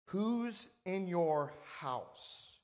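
very drawn-out "Who's in your house", with pauses; emphatic slow repeat. Real, not acted. Repeats sermon title for weight.

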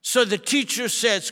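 usual speaking voice

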